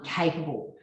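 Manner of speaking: ordinary delivery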